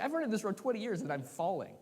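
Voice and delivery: high, loud, incredulous yelp; shocked disbelief. Retold or imitated. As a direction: squeal it like you can't believe you're falling. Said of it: "I was like".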